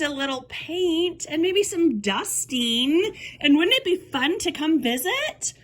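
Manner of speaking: high, singsong coaxing voice; mock cheery persuasion. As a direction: imitate an aunt breezily talking kids into it. Voicing aunt's breezy persuasion of kids.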